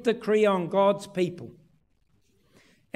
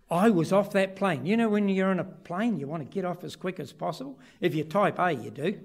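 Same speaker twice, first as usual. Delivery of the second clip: normal talking voice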